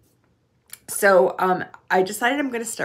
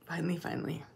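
finally, finally trailing off much softer; fading relieved whisper; 9 dB quieter, much faster than usual. Real, not acted. To viewers, savouring the long-awaited walk, fading whisper. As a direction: let finally, finally sink away to almost nothing.

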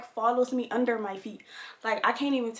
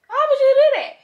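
higher, louder repeat of the question; exasperated yell; 13 semitones higher, 11 dB louder than usual. Real, not acted. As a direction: shout it at the absent puppy.

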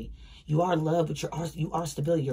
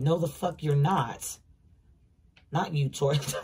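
ordinary delivery

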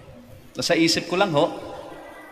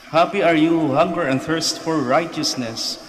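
raised, ringing proclamation; proclaiming scripture; 5 semitones higher, 4 dB louder than usual. Acted. Priest reading the Beatitudes aloud to the faithful, raised. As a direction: lift the blessing up over the pews.

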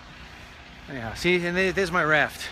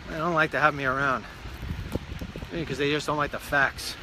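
normal talking voice

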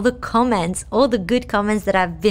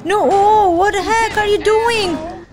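exasperated; 7 semitones higher than usual. Real, not acted. Cops crash into her.

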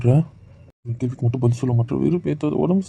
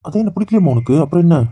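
raised, higher voice, hurt and accusing; angry outburst; 5 semitones higher, 7 dB louder than usual. Acted. Husband lashing out at his sick wife, angry and hurt. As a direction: throw it at her like an accusation.

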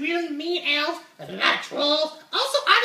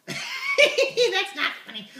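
laughing puppet; 8 semitones higher, much slower than usual. Acted.

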